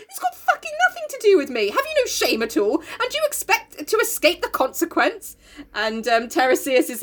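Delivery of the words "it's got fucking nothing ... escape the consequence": mock rant. Oedipus ranting at Tiresias.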